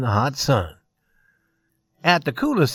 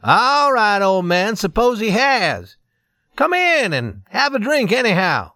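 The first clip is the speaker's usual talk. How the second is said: joking; 6 semitones higher than usual. A narrator's reading. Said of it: "jokingly answered".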